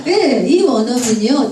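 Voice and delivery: ordinary talk, nothing marked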